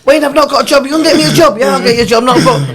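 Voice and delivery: eager voice. Retold or imitated. Player asks for job.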